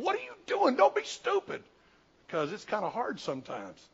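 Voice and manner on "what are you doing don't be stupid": high, loud furious outburst; angry yell. Retold or imitated. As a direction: yell it like the livid director. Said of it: "started yelling".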